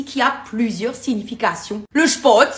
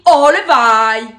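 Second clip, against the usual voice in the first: emphatic refusal; 7 dB louder than usual. Shown as a demonstration. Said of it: "nous on dit".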